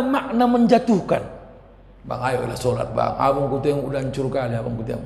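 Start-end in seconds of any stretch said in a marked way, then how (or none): 2.0-5.0 low, soft nagging, acted; pleading wife. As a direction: coax a husband quietly, in a low voice. Acted wife nagging husband to pray. (istri membujuk suami dengan suara pelan)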